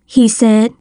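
ordinary delivery, nothing marked

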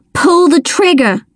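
high-pitched urging; urgent order. A narrator's reading. Child urging a frightened friend to fire, urgent.

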